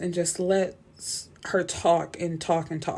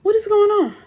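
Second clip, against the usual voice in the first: high, louder what is going on; shocked disbelief; 5 dB louder than usual. Retold or imitated. Reader reacting to Trip ignoring Alex, in high shocked disbelief. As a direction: blurt it out, thrown by the scene. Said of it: "So I'm like".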